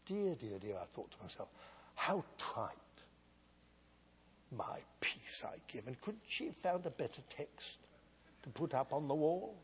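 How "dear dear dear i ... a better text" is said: hushed, sniffy dismissal of the text, fading to a whisper; arrogant scorn. Retold or imitated. Preacher mocking her own arrogant younger self, hushed and dismissive. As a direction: quietly sneer at how trite it is. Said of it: "This is how arrogant I was".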